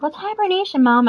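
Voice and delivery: put-on boy asking his mom, high; curious kid. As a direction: ask like a puzzled boy. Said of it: "was like".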